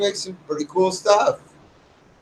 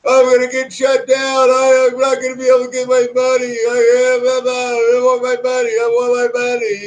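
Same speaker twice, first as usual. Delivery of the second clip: higher, mocking whine of a worried viewer; mock whining; 7 semitones higher, 3 dB louder than usual. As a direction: imitate a whiny complainer, higher. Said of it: "they're all whining in the sidebar".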